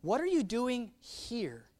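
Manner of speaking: slow build to a separate HERE; pause, then land on HERE; stress on HERE. Shown as a demonstration. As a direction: question with HERE set apart and emphasized. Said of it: "Or what if he said it this way".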